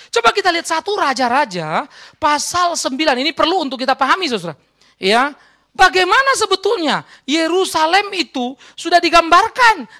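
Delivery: sustained high, loud, excited delivery; full-force preaching. Real, not acted. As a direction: press the point on the congregation at full voice. (khotbah lantang penuh semangat)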